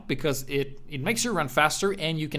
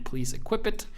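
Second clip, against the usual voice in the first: ordinary talk, nothing marked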